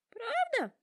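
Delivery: hopeful giraffe. A narrator's reading. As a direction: ask with tearful hope, pitched high. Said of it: "с надеждой смотрит".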